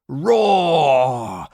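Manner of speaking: a big beastly roar. A narrator's reading. Narrator roaring as a stampeding dinosaur. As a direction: roar like a panicked titanosaur.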